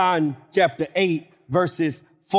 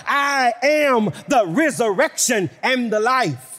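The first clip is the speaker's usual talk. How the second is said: steady, ringing pulpit delivery, slowing at the end; preaching; 6 semitones higher than usual. Acted. Preacher reciting the I am sayings, ringing proclamation. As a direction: proclaim each verse like a rising list.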